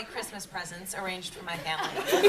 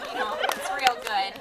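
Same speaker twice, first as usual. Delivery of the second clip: a quick, hammed-up Minnesotan accent at a higher pitch. Shown as a demonstration. Panelist demos a Minnesotan accent for the audience, playful Minnesotan accent. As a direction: Minnesotan twang, drawn out and breathy. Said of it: "like, Minnesotan".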